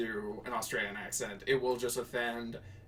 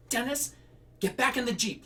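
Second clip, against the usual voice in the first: high exasperated call; yelling at husband; 10 semitones higher than usual. Acted. Louise yells at her husband, high exasperated call. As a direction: yell at him to get back in. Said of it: "Louise yelled at her husband".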